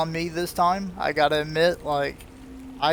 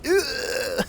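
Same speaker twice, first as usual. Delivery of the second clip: disgusted ugh; 8 semitones higher than usual. Retold or imitated. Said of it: "I was like".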